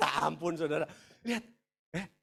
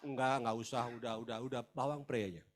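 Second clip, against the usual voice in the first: low, soft, dismissive refusal; menolak tawaran; 10 semitones lower, 5 dB quieter than usual. Acted. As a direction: wave the offer away in a low, small voice. Umat menolak tawaran Tuhan. (menolak pelan dengan suara rendah)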